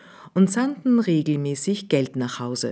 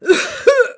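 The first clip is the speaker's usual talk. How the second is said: high, loud faked whooping cough; mock whooping cough; fake cough; 8 dB louder than usual. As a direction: hack out a put-on cough.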